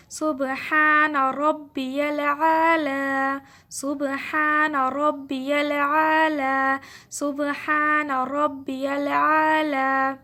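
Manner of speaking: سبحان ربی الاعلی chanted very slowly and loudly, each syllable held; slow recited tasbih. Shown as a demonstration. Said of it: "میرے ساتھ پڑھیں".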